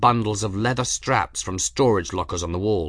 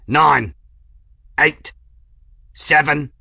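loud, high countdown; shouted countdown; 6 semitones higher, 8 dB louder, slower than usual. A narrator's reading. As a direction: count it down over the noise. Countdown shouted in engine room.

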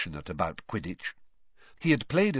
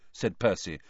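ordinary delivery, nothing marked

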